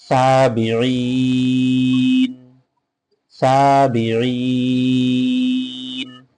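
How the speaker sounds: সাবিঈন with ঈ stretched long, ending on a silent ন; slow tajweed chant; pausal recitation. Shown as a demonstration. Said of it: "এখানে থামবো তখন অবশ্যই সাকিন".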